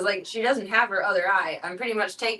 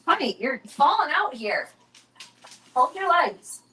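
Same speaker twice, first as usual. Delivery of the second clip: high sing-song voice for the dog; high cooing talk to a sprawling dog; pet talk; 5 semitones higher than usual. Real, not acted. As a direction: coo at the puppy. Talking to dog eating.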